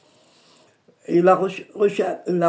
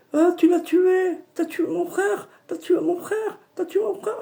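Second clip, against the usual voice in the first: high wailing lament, much higher pitch; wailing grief; 12 semitones higher than usual. Retold or imitated. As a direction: wail it like a grieving spirit. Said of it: "Il a commencé à pleurer". (le djinn pleure son frère : lamentation aiguë et gémissante)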